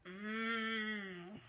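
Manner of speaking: soft closed-mouth groan. A narrator's reading. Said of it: "I groaned".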